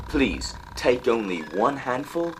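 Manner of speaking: ordinary delivery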